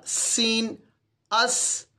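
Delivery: "سین" and "اس" with a clear hissing s; name "سین", then end "اس" on a sharp sss; whistled s closing "اس"; the confusable letter. Shown as a demonstration. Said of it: "mix ہو جائے گا".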